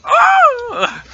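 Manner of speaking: very high, loud mock scream; flying orc's yell. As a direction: yelp like an orc knocked through the air. Acting the orc sent flying.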